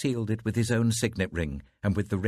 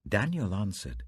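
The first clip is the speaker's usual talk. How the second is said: usual speaking voice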